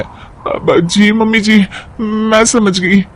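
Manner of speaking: meek bahu. Acted. Bahu submitting to stern mother-in-law, slow timid voice. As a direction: give in obediently.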